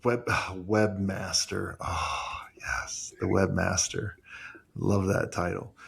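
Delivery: low, slow sigh of fond relish; savoring. Real, not acted. Relishing an old job title with the other speaker, savoring. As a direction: linger over it, dropping low and hushed.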